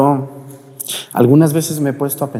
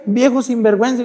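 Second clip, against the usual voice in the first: high, loud mock scolding; shrieked-up indignant rebuff; rude indignant patient; 4 dB louder than usual. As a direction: imitate an outraged patient telling off the visitor. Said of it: "me trataban bien mal".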